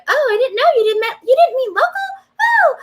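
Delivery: very high, mock-innocent squeal; feigned stupidity. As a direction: play dumb in a squeaky, over-sweet voice. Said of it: "I had to just claim that stupidity".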